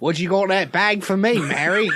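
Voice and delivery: loud, hammy fake cockney; rowdy bad cockney; bad cockney accent. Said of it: "I can't even do his bad accent".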